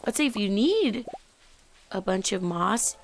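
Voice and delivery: usual speaking voice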